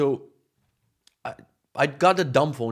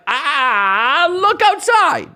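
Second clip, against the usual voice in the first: very high, loud, drawn-out comic scream; exasperated scream; 11 dB louder, much slower than usual. Retold or imitated. Tourist arguing with taxi driver in the rain, exasperated scream. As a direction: yell it slowly in shrieking disbelief.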